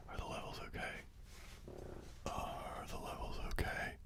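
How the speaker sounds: soft unvoiced whisper into the mic. Real, not acted. Whispering a level check to the stream. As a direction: breathe the words, no voice.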